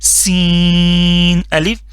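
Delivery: سین said with a sharp whistling s, drawn out slowly; whistling sin. Shown as a demonstration. Teacher showing students the whistling سین, slow. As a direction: let the s hiss like a whistle in سین. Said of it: "سی ٹی کی آواز نکالنی ہے".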